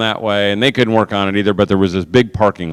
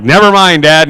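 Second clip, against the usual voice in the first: exasperated; 5 semitones higher, 7 dB louder than usual. Retold or imitated. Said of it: "And I'm like".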